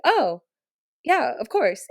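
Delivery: high, loud retold "oh"; imitating her own eager surprise; surprised agreement. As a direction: re-enact a surprised, willing yes. Surprised agreement to wingman's request.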